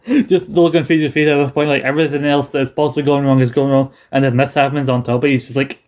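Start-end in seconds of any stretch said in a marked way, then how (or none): none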